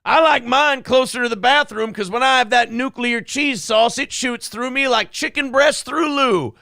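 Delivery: high, put-on hammy greeter; mock greeter voice. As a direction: sell it like a cheesy host. Continues the character bit.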